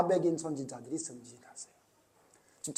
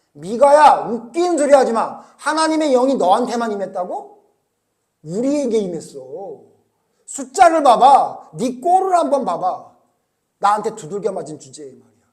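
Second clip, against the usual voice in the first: taunting; 4 semitones higher, 11 dB louder than usual. Retold or imitated. Zedekiah taunting Micaiah angrily. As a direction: belittle him, drawing it out.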